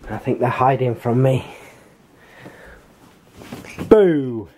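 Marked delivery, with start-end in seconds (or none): 3.9-4.5 loud jump-out boo; playful boo. Real, not acted. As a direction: pop out and startle them. Surprising hiding kids.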